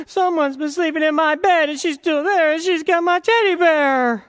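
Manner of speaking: put-on very high, loud little baby bear; a tiny bear whining that it's all gone; squeaky-high wee bear complaining. A narrator's reading. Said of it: "went over ... and said".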